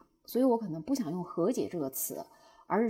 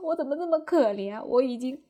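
retold breakdown; 3 semitones higher, 5 dB louder than usual. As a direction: re-enact a plaintive meltdown, voice raised. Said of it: "我又崩溃了".

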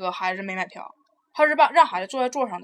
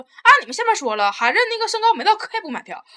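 smug excuse; 9 semitones higher than usual. Retold or imitated. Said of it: "完了你就那个劲儿说".

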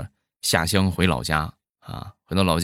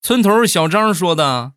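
heated retort; 8 semitones higher, 5 dB louder than usual. Acted. Heated argument in acted quote.

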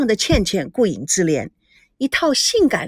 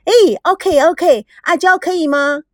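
eager and pitched up; delighted; 7 semitones higher, 3 dB louder than usual. A narrator's reading. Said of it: "吼吼高兴地拍着手说".

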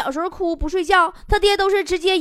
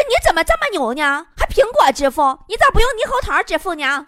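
louder, higher indignant comeback; mock exasperation; 5 semitones higher, 5 dB louder than usual. Shopkeeper to customer asking about Apple payment, worked up. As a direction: fire back in comic outrage. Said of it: "我当时就急了".

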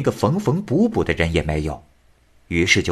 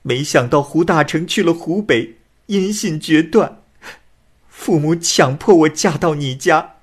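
higher, rising to louder defiance; a forced bride swearing she stays true; tearful young woman; 8 semitones higher, 3 dB louder than usual. A narrator's reading. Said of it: "女子又说".

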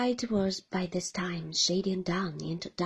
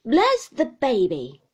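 slow, louder burst of exasperation; exclaiming; 4 semitones higher, 10 dB louder than usual. Acted. Exasperated aunt Betty exclaims at the young mother, loud and slow. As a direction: bark it out slowly. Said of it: "exclaimed Miss Betty".

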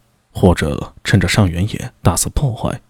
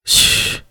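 hushing shh; 4 dB quieter than usual. A narrator's reading. Warning someone to hush.

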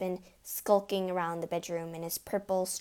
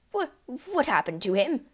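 spluttered; 5 semitones higher, 6 dB louder than usual. A narrator's reading. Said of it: "he spluttered".

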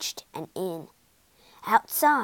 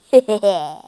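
loud, sly chuckle; gleeful laugh; 11 dB louder than usual. A narrator's reading. Toad watches Rat scurry away, laughing loudly. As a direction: cackle at having fooled him. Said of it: "Toad laughed".

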